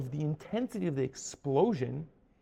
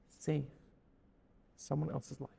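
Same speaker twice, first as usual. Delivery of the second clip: ordinary talk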